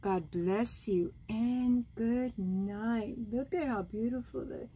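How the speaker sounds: ordinary delivery, nothing marked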